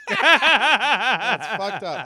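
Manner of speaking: loud laugh through the words; real laughter, slow and loud. Real, not acted. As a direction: burst out laughing as you say it. Laughing at friend's confession.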